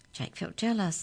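usual speaking voice

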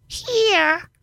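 very high, squawky call; raspy reply. Acted. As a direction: squawk it like a parrot. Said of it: "comes the raspy reply".

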